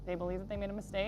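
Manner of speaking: hushed commentator. Pretend. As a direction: drop to a hushed golf-style call. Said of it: "like an esports commentator".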